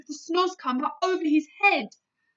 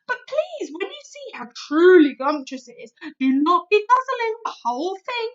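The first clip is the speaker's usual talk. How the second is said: louder, higher, pleading playfully; BFG, sly; 5 semitones higher, 8 dB louder than usual. A narrator's reading. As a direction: beg for a bit left over. BFG voice, tempting slyly.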